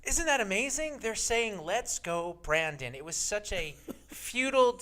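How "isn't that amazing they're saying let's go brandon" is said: high-pitched mock amazement, easing down; mock TV reporter. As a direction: imitate a reporter putting a cheery spin on it. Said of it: "the prostitute with the microphone says".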